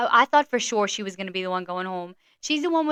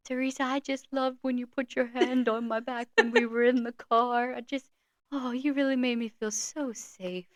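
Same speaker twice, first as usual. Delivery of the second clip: slow, sappy suitor imitation; mock-tender romantic confession; Gary impression; 3 semitones higher, 3 dB quieter than usual. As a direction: tease a lovestruck man's earnest drawn-out sweet talk. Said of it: "how Gary talks".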